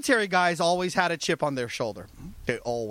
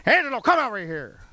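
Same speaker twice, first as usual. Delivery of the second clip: higher-pitched Southern boss summons; mock Southern boss calling out; Southern accent; 4 semitones higher, 3 dB louder than usual. Boss calling his recruiter over, imitated Southern accent.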